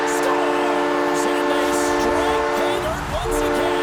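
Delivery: stretched-out, excited scoring call; excited goal call. Real, not acted. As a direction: call the goal over the horn, drawing it out. Commentator calls a goal live.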